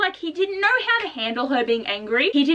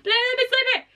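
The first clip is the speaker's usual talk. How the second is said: mock begging; 12 semitones higher than usual. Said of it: "he's just like".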